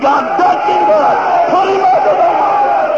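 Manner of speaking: loud, high-pitched revival shouting; full-force preaching. Real, not acted.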